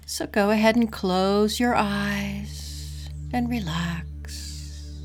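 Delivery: calm, unhurried breathing guide; guided meditation. Acted.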